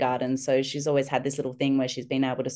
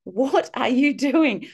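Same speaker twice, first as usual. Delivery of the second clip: higher, slow incredulous question; imitated baffled teacher; 9 semitones higher, 4 dB louder, much slower than usual. Teachers on duty seeing mum duck-walking, slow higher disbelief. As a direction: stretch it out in mock disbelief. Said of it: "teachers on duty are just like".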